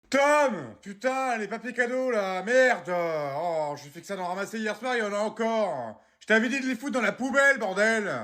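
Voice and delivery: high, exasperated scolding shout; angry yelling. Acted. Parent finding gift wrap left around, yelling angrily. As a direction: yell for the culprit, then rant on. Parent yells at son over wrapping paper.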